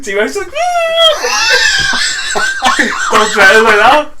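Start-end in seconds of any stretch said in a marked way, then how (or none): 0.5-3.1 high helpless shriek of laughter; real hysterical cackle. Real, not acted. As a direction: crack up completely, squealing. Howling at own story.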